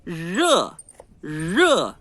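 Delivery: Rê retroflexo com vogal nasal. Shown as a demonstration. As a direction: dobre a língua no Rê nasal. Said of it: "pôr o queixinho da gente pra frente". (rê com queixo à frente)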